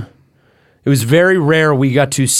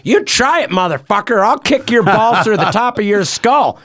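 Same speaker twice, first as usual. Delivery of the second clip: dad's cop voice; 8 semitones higher than usual. Retold or imitated. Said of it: "And my dad just goes".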